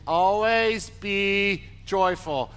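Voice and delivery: "Always be joyful" drawn out slowly, each word leaned on; slow call and response. Shown as a demonstration. Pastor leading the congregation, slow and drawn out. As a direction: lead the church slowly through "Always be joyful". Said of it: "say those first three words with me".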